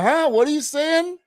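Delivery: high-pitched puzzled question; baffled listener. Retold or imitated. Host imitating co-host lost by his dialect, baffled. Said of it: "You would be like".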